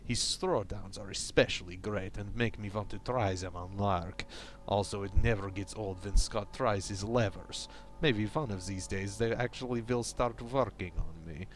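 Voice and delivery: a lightly done fake Eastern European accent reading the diary; bad Russian accent. Gamer reading crew member Pavel's diary entries aloud, in a light fake Russian accent. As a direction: read the log in a hammy Russian accent, only a hint of it. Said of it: "my bad, fake, slightly racist Russian slash Eastern European accent".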